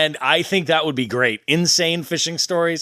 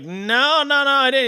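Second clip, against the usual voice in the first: retold denial; 11 semitones higher than usual. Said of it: "And I was like".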